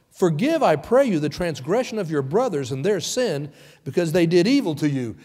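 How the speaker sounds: high, loud, hammed-up plea; mock pleading father. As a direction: lay it on thick as a dying wish. Brothers' fake deathbed message.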